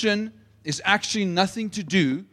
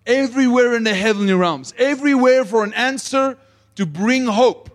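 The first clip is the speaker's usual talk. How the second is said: loud, higher, urgent preaching climb; full-force preaching; 7 semitones higher, 5 dB louder than usual. Real, not acted. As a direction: preach it out, pressing harder line by line.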